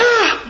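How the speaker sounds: high-pitched yelp of fright; mock scared scream. Little lion sees a lion ripped apart, mock scream. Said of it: "He's all scared".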